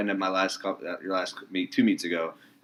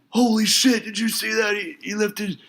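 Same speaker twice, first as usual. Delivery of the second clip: amazed gym crowd; 4 dB louder, slower than usual. Retold or imitated.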